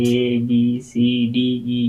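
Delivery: letter names ᱮ ᱵᱤ ᱥᱤ ᱰᱤ said in a row like the ABC; teacher reciting the ABC; reciting English alphabet. Shown as a demonstration. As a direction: rattle off the English letters as an example. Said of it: "ᱡᱮᱭᱥᱮ ᱮ ᱵᱤ ᱥᱤ ᱰᱤ ᱵᱚᱱ ᱯᱟᱲᱦᱟᱣᱟ".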